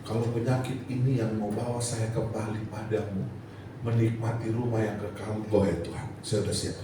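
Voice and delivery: low, softened plea to God; resigned prayer. Retold or imitated. Patient praying he is ready to go home to God, low and resigned. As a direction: pray quietly, giving yourself up.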